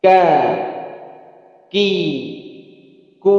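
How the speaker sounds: Ka, ki, ku with the K pressed and held before release; clean, deliberate syllables; crisp kaf. Shown as a demonstration. Teacher demonstrating kaf syllables for students to copy, crisp. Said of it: "Ulangi lagi".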